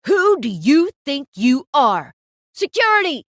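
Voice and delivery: roaring, furious tirade at the model; explosive, loud yelling rage; fuming photographer. Acted. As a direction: scream insults like a boss gone wild. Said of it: "he was fuming now".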